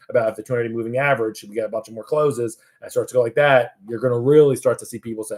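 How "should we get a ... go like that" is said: normal talking voice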